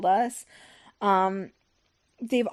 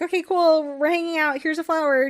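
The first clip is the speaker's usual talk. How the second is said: high, louder overly friendly chatter; mock sweet; 6 semitones higher, 3 dB louder than usual. [name] offers Felicity a flower, mock sweet. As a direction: cheerfully pretend all is normal.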